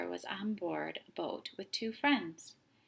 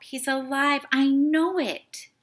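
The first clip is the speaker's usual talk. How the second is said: slow, loud, stubborn insistence; boy refusing to accept the loss, insistent; 6 semitones higher, 11 dB louder than usual. A narrator's reading. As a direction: refuse to give up. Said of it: "Tim wouldn't give up".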